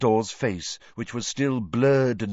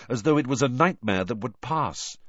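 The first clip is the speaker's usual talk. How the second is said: plain reading voice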